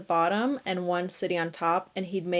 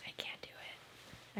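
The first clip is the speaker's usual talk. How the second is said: defeated whisper; 22 dB quieter, slower than usual. Real, not acted. Failing at contact juggling.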